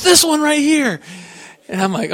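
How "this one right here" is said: put-on excited child voice. Daughter picks big tree.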